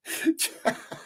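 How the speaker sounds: real burst of airy laughter; high breathy laugh. Real, not acted. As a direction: laugh at the chat squabble. Laughing at chat banter.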